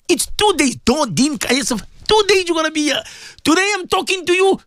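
high-pitched, urgent exhortation; fervent radio sermon, pitched well up; impassioned preaching. Real, not acted.

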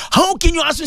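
indignant shout. Real, not acted. Preacher challenges listeners who doubt, indignant shout. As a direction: call it out in mock outrage.

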